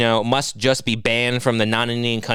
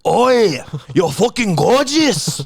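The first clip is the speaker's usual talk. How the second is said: loud, high mock-Scottish shout; drawn-out Scottish catcall, hammed up; Scottish accent; 6 semitones higher, 4 dB louder, much slower than usual. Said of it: "Is this the Scottish one?".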